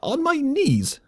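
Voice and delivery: very high, choked squeak; sputtering lemming fury. Acted. Enraged lemming colonel fumbles his threat, high choked squeak. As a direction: blurt it out, too angry to think. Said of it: "speechless with fury".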